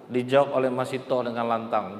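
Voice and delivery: usual speaking voice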